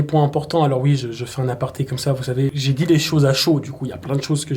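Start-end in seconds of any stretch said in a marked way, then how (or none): none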